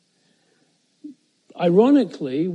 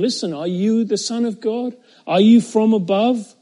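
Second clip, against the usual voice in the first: urgent questioning; 6 semitones higher, 6 dB louder than usual. Acted. Pilate pressing Jesus.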